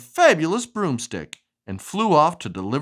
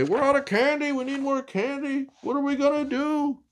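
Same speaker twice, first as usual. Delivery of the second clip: worried townsfolk; 10 semitones higher than usual. Acted.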